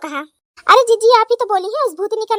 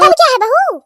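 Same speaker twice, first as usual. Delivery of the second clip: puzzled; 4 semitones higher, 5 dB louder than usual. Acted. Puzzled mother-in-law.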